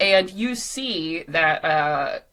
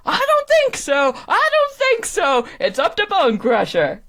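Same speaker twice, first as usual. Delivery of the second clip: hyped contest announcer; 12 semitones higher, 7 dB louder than usual. Acted. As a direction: whip up the crowd like a game-show host.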